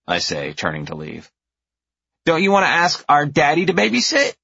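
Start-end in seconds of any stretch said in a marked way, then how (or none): 2.3-4.3 put-on high child question; teasing little girl. A narrator's reading. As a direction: tease like a sly kid. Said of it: "Louise asks".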